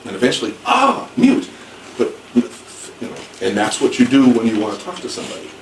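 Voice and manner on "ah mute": much higher, bright "ah" of getting it; the penny drops; sudden realization. Retold or imitated. Teacher retells finally understanding the student's word.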